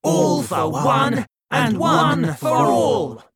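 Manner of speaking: high, loud, slow rallying shout; group shout. A narrator's reading. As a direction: roar the motto together, united. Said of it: "the four of them repeated".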